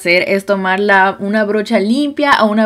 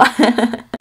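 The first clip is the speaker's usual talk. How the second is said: pleased little laugh; 3 semitones higher than usual. Real, not acted. Laughs at her own makeover.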